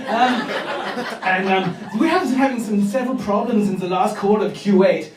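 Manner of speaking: mock German sales manager; comic German-accented business English. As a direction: address the meeting with a German accent. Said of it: "a sales meeting and yet you're working for a German".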